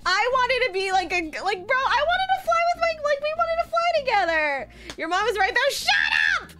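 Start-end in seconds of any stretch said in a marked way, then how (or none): 1.4-4.7 whiny protest. Real, not acted. Protesting to listeners. 5.7-6.5 high-pitched yelp; exasperated retort. Real, not acted. Snapping at teasing listener.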